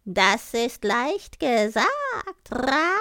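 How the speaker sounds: high, slow crow voice with loud caws; raspy cawing crow. Acted. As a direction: croak it proudly like a talking crow. Crow speaks in the tale.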